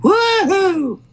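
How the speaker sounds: cheering. Real, not acted. Cheering after the performance.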